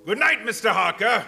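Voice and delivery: booming sinister goodnight; loud mocking farewell. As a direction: relish the dismissal. Dracula sends Harker off.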